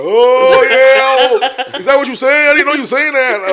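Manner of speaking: loud sarcastic mock realization; mocking cousins. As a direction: ham up the cousins' sudden understanding. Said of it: "All my cousins like".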